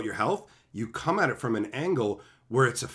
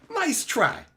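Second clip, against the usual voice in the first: teasing again; 13 semitones higher than usual. Real, not acted. Teasing chat once more, high mocking dismissal.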